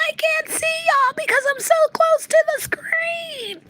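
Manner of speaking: very high, loud mock squeal; mocking camera hogs. Streamer mocking women crowding the camera, squealing falsetto. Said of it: "They be like this".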